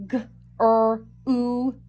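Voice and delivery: "G-R-U-P" as four separate sounds, g, r, oo, p; sounded out. Shown as a demonstration. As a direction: sound out "G-R-U-P" slowly, sound by sound, not letter names. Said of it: "This word is group".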